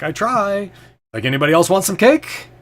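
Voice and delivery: high, loud cheerful boast; jolly Fabler. Acted. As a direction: offer cake like a proud showman.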